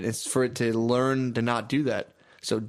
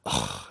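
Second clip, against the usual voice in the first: low, soft disgusted groan, imitated; mock disgusted groan from a lady at the park; disgusted ugh; 5 dB quieter than usual. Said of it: "she saw me and went".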